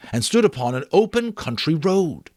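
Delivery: ordinary delivery, nothing marked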